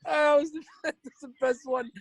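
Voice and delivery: high, loud, crying with laughter; laughing. Real, not acted. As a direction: shriek it through tears of laughter.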